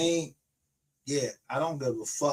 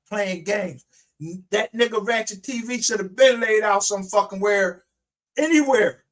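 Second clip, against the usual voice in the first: real heated rant, loud and high; worked-up, indignant outburst; angry rant; 6 semitones higher, 5 dB louder than usual. Real, not acted. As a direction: raise your voice in scornful anger. Ranting at an online rival.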